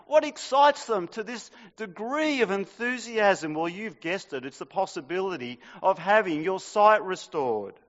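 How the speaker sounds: ordinary talk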